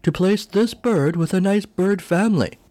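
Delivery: high, lofty imitation of a haughty bird lady; a grand bird chairwoman addressing the board; regal bird voice. Regal bird chairwoman reminding the board of their purpose, high and lofty. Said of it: "this very regal sounding bird".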